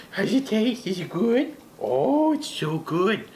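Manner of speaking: put-on high baby talk to the dog; doggy voice. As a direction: coo at the puppy in a sweet pet voice. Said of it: "That's my doggy voice".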